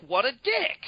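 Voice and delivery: high, scornful jab; disgusted. Retold or imitated. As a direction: sneer it out in disgust. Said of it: "I'm like".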